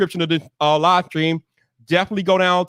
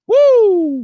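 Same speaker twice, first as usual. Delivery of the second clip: high, louder whoop; excited whoop; 14 semitones higher, 6 dB louder than usual. Real, not acted. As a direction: cheer the filling room. Hyped as chat fills up.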